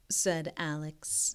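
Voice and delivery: ordinary delivery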